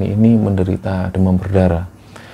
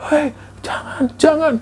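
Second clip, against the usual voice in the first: high cracking cry of fear, much higher; panicked cry. Retold or imitated. Mother cries out at the old woman by the bed, high panicked cry. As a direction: cry out in terror at the ghost. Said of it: "langsung teriak-teriak".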